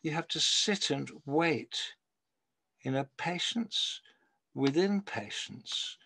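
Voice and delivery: slow, measured poetry recital with long pauses; poem reading. Acted. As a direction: read it like a poet savouring each line.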